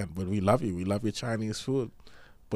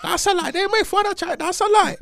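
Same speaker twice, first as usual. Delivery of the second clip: put-on high, shouted Chinese-accent caricature; mock Chinese accent; 9 dB louder than usual. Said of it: "talk like a Chinese".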